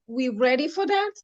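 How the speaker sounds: ordinary delivery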